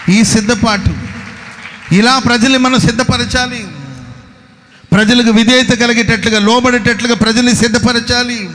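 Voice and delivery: loud, high, driving preacher cry; full-force preaching. Acted. Pastor rousing the congregation to readiness, full-force preaching. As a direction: thunder it at the congregation.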